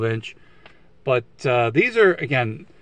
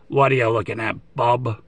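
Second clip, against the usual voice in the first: Wolverine impression. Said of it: "Trying to do my best Wolverine animated series, uh, impression".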